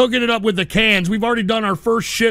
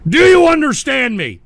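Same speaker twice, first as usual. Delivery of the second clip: loud, higher-pitched demand; real angry demand; 6 semitones higher, 7 dB louder than usual. Real, not acted. Host ranting about booed anthem, angry shout. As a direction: raise your voice to drive it home. Angrily repeats demand after Canada rant.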